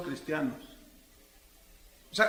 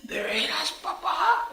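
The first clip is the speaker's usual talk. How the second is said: put-on much higher, amazed girl; daughter's delight; 10 semitones higher, slower than usual. Daughter delighted by her parents' story. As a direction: squeal it like a thrilled daughter.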